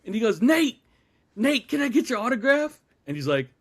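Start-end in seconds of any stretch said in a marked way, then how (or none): 0.4-2.8 high, loud imitation of an eager fan; eager calling out. Friend asks singer Nate for an autograph, calling out eagerly. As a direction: holler for the star's attention.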